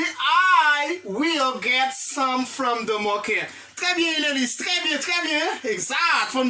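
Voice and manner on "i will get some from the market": slow modelled reply, word by word; lecture lente. Shown as a demonstration. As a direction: give the class the model answer slowly. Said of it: "Il dit". (réponse modèle dite lentement)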